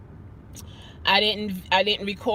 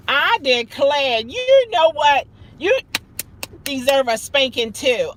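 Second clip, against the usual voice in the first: playful loud scolding; 5 semitones higher, 7 dB louder than usual. Acted. Teasing a viewer in the chat.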